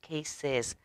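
ordinary delivery, nothing marked

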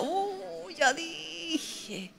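very soft, drawn-out regret; mock rueful moan. Lamenta lo que dijo. (lamento bajito y arrepentido)

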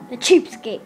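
mocking jab. Mocking Tom Nook.

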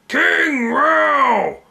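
high ringing call; shouting. Acted. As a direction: cheer the king's name. Crowd hails the king.

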